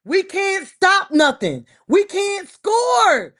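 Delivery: loud, slow, drawn-out shout; frustrated yelling. Real, not acted. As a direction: hammer each word in exasperation. Angry fan rant about team.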